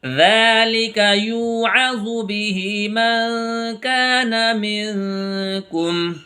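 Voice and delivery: slow, drawn-out melodic tajweed chant; Quran recitation. Acted. Teacher reciting verses for students.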